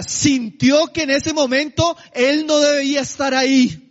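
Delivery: ordinary delivery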